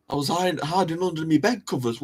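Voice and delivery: ordinary delivery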